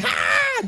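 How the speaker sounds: sudden high, loud mock shriek; short hammed-up scream of fear; mock scared scream. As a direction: act out the disciples' fright.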